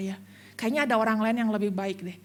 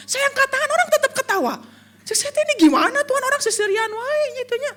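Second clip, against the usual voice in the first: high, louder comic whine; 11 semitones higher, 6 dB louder than usual. Retold or imitated. Pastor complaining to God nobody cries, high comic whine.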